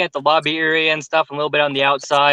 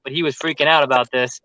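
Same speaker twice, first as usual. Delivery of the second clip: usual speaking voice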